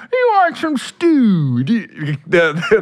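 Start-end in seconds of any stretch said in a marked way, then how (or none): 0.1-1.6 put-on high, slow Cajun accent. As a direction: imitate his Cajun accent. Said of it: "Cajun, he's like this".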